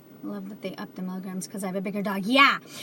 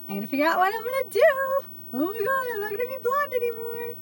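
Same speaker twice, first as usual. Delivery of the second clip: much higher, half-laughing mock wail; mock despair; 12 semitones higher, faster than usual. To viewers about her hair appointment, a hammed-up mock wail. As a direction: ham up the tragedy of losing your blonde hair. Panics over losing blonde hair.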